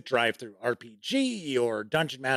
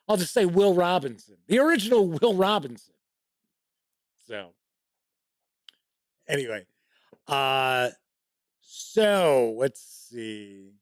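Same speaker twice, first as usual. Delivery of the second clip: ordinary talk, nothing marked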